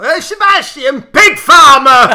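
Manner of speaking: posh Sebastian, then a loud northern Pig Farmer; posh then northern shout. Shown as a demonstration. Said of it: "posh accent the first bit and proper northern the second bit".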